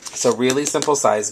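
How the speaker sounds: ordinary talk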